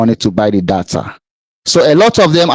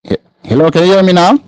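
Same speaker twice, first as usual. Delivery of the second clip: high, raised calling voice; across the street; 7 semitones higher, faster than usual. Real, not acted. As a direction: call out to a lost caller. Caller can't hear host.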